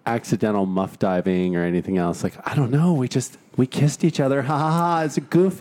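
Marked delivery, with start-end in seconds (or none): 2.5-5.6 high, giggly mock girl; high girly voice. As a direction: brush it off, high and silly. Acting out girl brushing it off.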